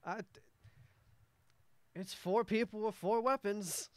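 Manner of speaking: high, loud, tumbling over his words; a frantic merchant begging adventurers for help; desperate scraggly merchant. Acted. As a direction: blurt it out as he rushes up. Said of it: "He's just like".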